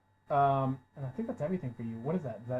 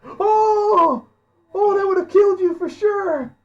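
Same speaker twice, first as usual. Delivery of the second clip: excited shouting; 11 dB louder, slower than usual. Real, not acted. Crit roll, GM shouts in excitement.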